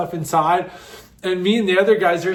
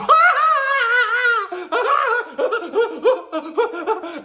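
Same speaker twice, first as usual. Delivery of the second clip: shrieking laughing fit. Real, not acted. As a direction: laugh till you scream. Most intense laughing fit.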